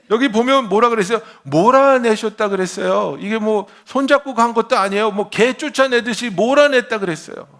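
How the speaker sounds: loud, high, fervent preaching; full-force preaching. Real, not acted. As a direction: drive the point home to the crowd.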